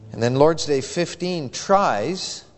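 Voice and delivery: slow, with tries leaned on hard; stress on tries. Shown as a demonstration. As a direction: make tries the word that lands. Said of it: "I emphasize the word tries".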